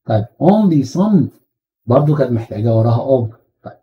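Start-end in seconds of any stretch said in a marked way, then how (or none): none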